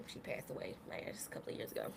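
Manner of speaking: whispered, voice dropping away; hushed, barely voiced grief. Real, not acted. Speaking of mother's death.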